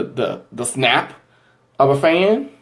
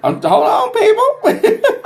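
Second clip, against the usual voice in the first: giggling through the words, pitched high; laughing; slower than usual. Real, not acted. Laughing at a flood of fan comments, high giggly voice. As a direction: laugh out the words, barely holding it together.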